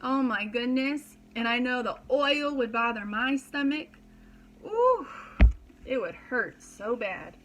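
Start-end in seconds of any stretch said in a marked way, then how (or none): none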